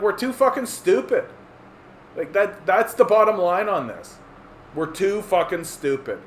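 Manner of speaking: ordinary talk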